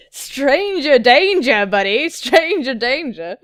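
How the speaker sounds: loud, slow, stretched warning; hammed-up alarmed shouting; mock alarm. Mock alarm at being grabbed.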